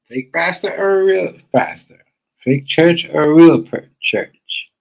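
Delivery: escalating slow, loud mockery. Real, not acted. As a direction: belt the question out mockingly. Commentator mocks the pastor.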